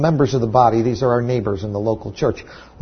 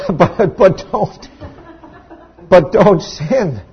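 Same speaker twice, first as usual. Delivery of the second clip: slow, chuckling through the words; laughing; 5 semitones higher, 4 dB louder, much slower than usual. Real, not acted. Preacher chuckling at his own joke, laughing slowly. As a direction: laugh as the punchline lands.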